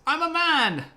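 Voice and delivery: very high, loud offended insistence; indignant peasant. Retold or imitated. Dennis insisting to the king, very high and loud. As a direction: insist in a shrill huff. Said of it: "He goes".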